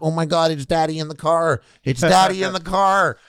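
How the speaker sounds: high, rising cry of fake alarm; mock panic. Imitated children reminded of dad.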